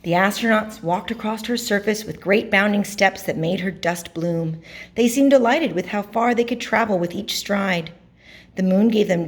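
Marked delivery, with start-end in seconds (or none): none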